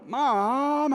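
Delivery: high, loud, casual "Mom". Retold or imitated. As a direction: call out "Mom" the way a kid would. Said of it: "Jesus would not go".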